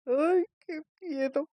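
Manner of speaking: high, slow squeal of mock fright. Host shrieking at a creepy detail, slow high mock fright. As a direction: shriek it like a spooked fan. Host reacts scared to story.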